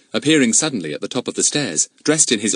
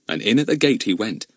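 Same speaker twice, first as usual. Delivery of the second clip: ordinary delivery, nothing marked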